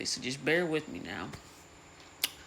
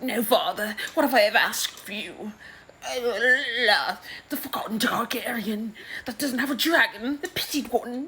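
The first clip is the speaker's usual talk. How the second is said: higher put-on voice, aggrieved and self-pitying; sulky daughter; 9 semitones higher, 3 dB louder than usual. Rhaena complaining to her father, higher self-pitying voice. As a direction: play the wounded forgotten child.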